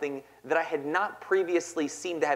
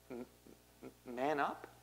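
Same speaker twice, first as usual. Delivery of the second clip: much softer, halting, stammered disbelief; small stunned boy; 5 semitones lower, 14 dB quieter, slower than usual. Retold or imitated. Crying six-year-old repeating the coach, stammering softly. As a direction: stammer it like a hurt little kid. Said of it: "And I was like".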